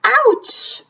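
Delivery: high yelp of pain, clipped short; sharp ouch. Acted. Mother cries out when sat on, sharp high ouch.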